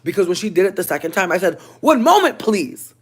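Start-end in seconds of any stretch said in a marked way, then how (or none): 1.8-2.9 high, loud, prim customer-service voice; Caucasian polite voice. Retold or imitated. To the knocking coworker, a high prim polite voice. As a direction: chirp it like a polite office lady. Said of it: "she really made my Caucasian come out".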